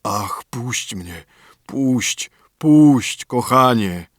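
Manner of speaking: begs, starting hushed and breaking into a cry; pitiful plea. A narrator's reading. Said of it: "krzyknął wielce żałosnym głosem".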